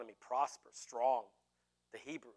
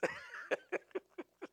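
high laugh dying away quieter; laughter; 14 semitones higher, 9 dB quieter than usual. Real, not acted. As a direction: let the laughter subside. Laugh trails after apology.

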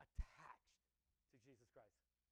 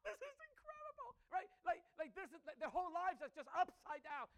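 excited amazement; 14 semitones higher, 15 dB louder than usual. Real, not acted.

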